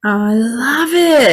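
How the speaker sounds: high, drawn-out gush of delight. Real, not acted. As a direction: let it swell slowly with real delight. Host reacts delightedly to story.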